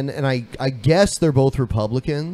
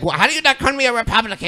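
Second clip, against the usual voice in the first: a hammed-up outraged woman, higher and fast; mock indignant woman; 7 semitones higher, much faster than usual. Mimicking the panelist as she objects, a high fast mock outrage. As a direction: toss it back like an offended panelist, quick and high. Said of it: "She's like".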